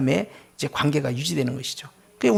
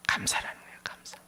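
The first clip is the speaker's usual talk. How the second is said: whispered answer, far softer; hushed secret; 13 dB quieter than usual. Real, not acted. Preacher giving the congregation the secret answer, whispered.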